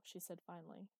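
ordinary delivery, nothing marked

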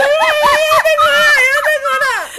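hammed-up tipsy protest; mock drunk denial. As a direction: play your drunk self insisting you're fine.

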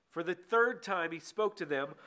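ordinary delivery

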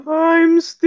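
high, slow wailing ghost voice. Acted. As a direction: moan it out like a ghost. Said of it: "[name]'s spot-on impression of a ghost".

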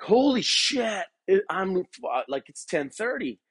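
high, slow shocked gasp of a line; startled retelling of a late start; panicked realization. Retold or imitated. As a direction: relive the moment you see the clock. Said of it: "and I'm like".

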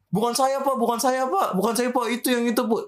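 high, hurried-sounding mock pleading; panicked protest. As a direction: act out a wrongly accused victim pleading. Wrongly doused person protests in story.

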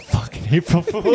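slow, loud mocking blurt; loud gleeful jab. Real, not acted. Friend blurts the April Fool joke, loud slow jab. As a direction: slam the punchline home.

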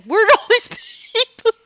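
high, loud, laughing imitation of bewilderment; mock bewilderment. Telling how thirteen people flooded a party, mock bewildered. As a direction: imitate a baffled host, breaking into laughter. Retold reaction to sudden crowd.

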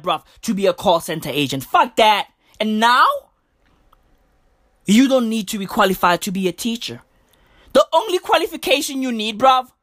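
normal talking voice

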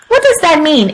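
ordinary delivery, nothing marked

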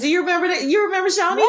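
light up at the shared memory; excited recall. Real, not acted. Delighted recall of shared restaurant.

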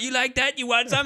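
high, worked-up imitation of a reckless soldier; mock taunting. Retelling the soldier charging the bugs, high mock taunt. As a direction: taunt the enemy in a high put-on cry. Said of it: "runs in there like".

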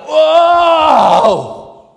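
a deafening drawn-out groan; loud groan. Shown as a demonstration. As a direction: bellow a groan from the gut. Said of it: "Sorry. Didn't mean to deafen you".

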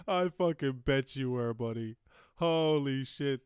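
loud, thrilled outburst; excited. Real, not acted. Streamer cheering the song clip, loud and excited. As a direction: shout it with delight.

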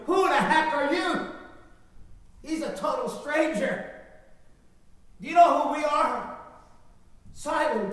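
indignant fishermen. Acted. Fishermen indignantly protesting to Jesus.

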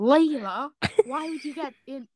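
flat-mouthed male voice; lightly voiced boy character. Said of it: "To do the voice".

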